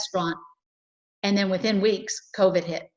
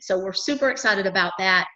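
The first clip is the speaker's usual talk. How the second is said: normal talking voice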